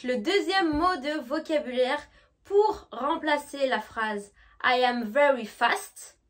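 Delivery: ordinary delivery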